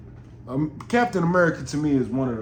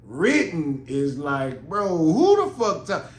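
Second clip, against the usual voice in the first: usual speaking voice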